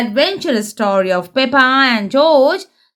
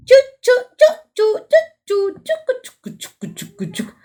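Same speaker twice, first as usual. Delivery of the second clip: a train puffing away into the distance, dying to a hush; train choo-choo; 11 semitones higher, faster than usual. Pretend. Reader opening a train story for kids with high choo-choos that fade to a whisper.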